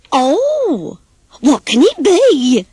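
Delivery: greedy anticipation, breathless; eager gasp. Acted. Pig to the hen, opening the bowl, excited gasp. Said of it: "gasped Peter Pig".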